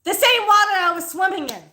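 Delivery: loud, high-pitched outrage; indignant outburst. Real, not acted. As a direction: protest it at the top of your voice. Indignant outburst over snake in water.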